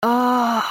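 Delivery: high screaming cry; pained scream. A narrator's reading. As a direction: scream over the storm. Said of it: "заорала я, перекрикивая ураганный рёв".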